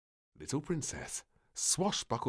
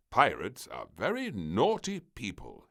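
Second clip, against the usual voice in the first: frowning admiral; 3 semitones higher, 6 dB louder than usual. Acted. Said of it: "he frowned".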